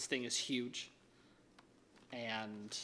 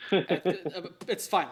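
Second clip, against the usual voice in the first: higher and much louder, flustered; 6 semitones higher, 14 dB louder, slower than usual. Acted. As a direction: blurt out that it's fine. Flustered NPC after stuff broken.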